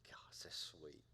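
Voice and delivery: hushed, low, half-whispered cooing; mock touched. Pastor reacting to something sweet for the congregation, hushed mock tenderness. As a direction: softly pretend to be moved to tears.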